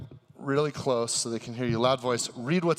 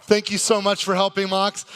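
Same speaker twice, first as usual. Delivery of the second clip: ordinary delivery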